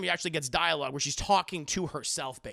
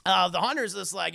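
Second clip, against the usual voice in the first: ordinary delivery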